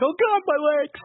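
high-pitched cartoon wail of pain; mock agony. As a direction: imitate a wailing stretcher patient. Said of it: "the guy on the stretcher going".

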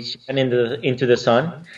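normal talking voice

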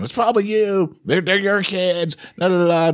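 high, singsong nagging imitation; mock critics. Imagined listeners blaming the parents, high mocking singsong. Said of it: "probably people out there saying".

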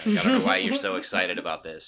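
unimpressed, lightly voiced gloomy dog; Eeyore-like dog. Dog to his overexcited owner, mock Eeyore gloom. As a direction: imitate a dog as glum as Eeyore. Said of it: "the dog was just like, "Eeyore".

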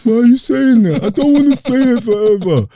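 put-on high, whiny little boy; high upset kid voice. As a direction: protest like a scared small child. Kid brother's panicked reply.

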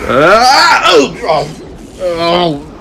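high yelp of surprise flying out; startled high oh-oh-oh as he tumbles out; hammed-up yelp; startled cry. Mark flying out of tunnel.

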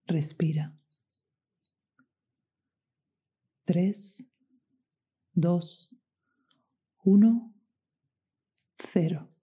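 whispered breathing cues; slow hushed countdown; slow breath counting. Acted.

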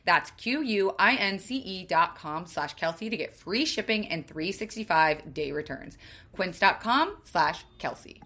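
soft, chatty sponsor read. Acted. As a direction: read the ad like a casual recommendation.

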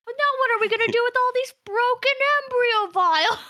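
high, loud, fast Stooge whine; Three Stooges whine. Acted. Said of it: "and he's just like".

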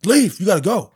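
high, loud order; ref ejecting. Retold or imitated. Referee ordering the dad to leave, loud high. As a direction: throw him out firmly. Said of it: "he's like".

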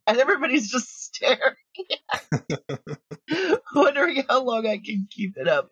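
words broken by real laughter; laughing while telling. Real, not acted. Laughing as he tells the interviewer about the screaming. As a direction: giggle through the memory. Recalling the fun screaming session.

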